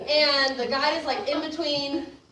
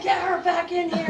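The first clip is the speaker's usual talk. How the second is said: urgent yell; 7 semitones higher, 5 dB louder than usual. Retold or imitated. Strangers pulling her in.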